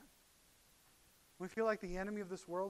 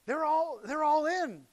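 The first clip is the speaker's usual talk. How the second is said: emphatic; 8 semitones higher, 11 dB louder, slower than usual. Real, not acted. Disciples ready to fight.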